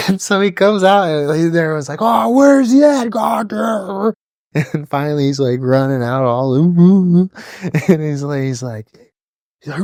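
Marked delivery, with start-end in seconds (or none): 2.0-4.1 high, slow, mock-shouted drill instructor; hammed-up drill sergeant yell. Drill instructor looking for recruit. 6.5-7.3 panicked yelps. Retold or imitated. Kid panicking running out.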